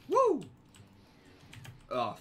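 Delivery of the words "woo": high loud woo; cheering whoop. Real, not acted. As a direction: whoop in triumph.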